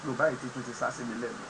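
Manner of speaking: ordinary talk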